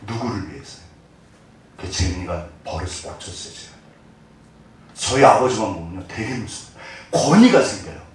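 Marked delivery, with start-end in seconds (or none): none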